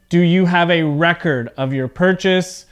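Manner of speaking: record as REK-erd inside the question; record with a weak -erd ending; noun stress on first syllable. Shown as a demonstration. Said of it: "you can hear my voice sound different".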